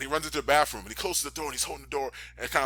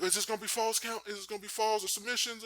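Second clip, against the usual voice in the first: high, mock-anxious questioning; mock nervous; 10 semitones higher than usual. Imitating a nervous wrestler asking the ref, mock nervous. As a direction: lightly play a jittery wrestler.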